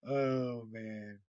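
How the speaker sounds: usual speaking voice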